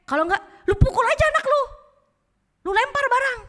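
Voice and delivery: high, loud, fast goading; devil egging on. Acted. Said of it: "dia mulai ngomong kayak gini".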